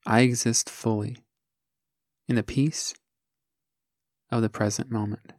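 measured meditation voice, long silences between phrases; guided meditation. Acted. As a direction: guide the listener gently, one short phrase at a time.

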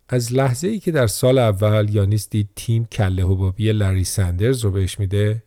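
ordinary delivery, nothing marked

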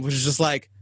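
ordinary talk

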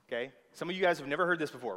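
ordinary delivery

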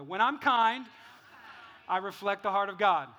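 loud, measured call to repeat; leading a repetition. Real, not acted. Pastor leading church saying it together, loud and deliberate. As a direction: project it for a crowd to echo.

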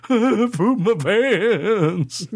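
put-on slow, high blubbering kid through laughter; hammed-up sob of a little boy; mock sobbing child. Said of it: "sobbing uncontrollably".

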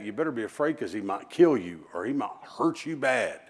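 normal talking voice

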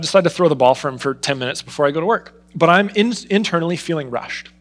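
ordinary delivery

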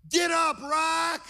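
high, loud yell at the TV; imitated yell. Son yelling at his wrestling hero, imitated high shout. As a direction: shout it like an excited young fan. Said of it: "Luke yells out".

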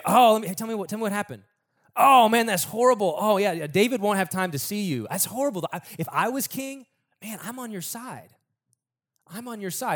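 mock-sympathetic politician winning someone over; sympathetic schemer. Acts Absalom sympathising with petitioners.

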